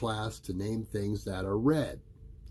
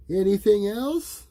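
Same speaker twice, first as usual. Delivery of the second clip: higher, louder teacher; 11 semitones higher, 8 dB louder than usual. A narrator's reading. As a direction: prompt the class for more. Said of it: "asked Missus Robinson".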